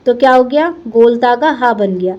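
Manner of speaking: ordinary talk, nothing marked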